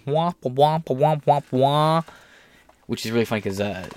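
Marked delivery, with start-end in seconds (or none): 0.0-2.0 higher muffled womp womp trombone voice; muffled wah wah talk. Retold or imitated. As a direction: imitate the Peanuts adults' womp womp. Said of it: "the Peanuts moms and parents talking".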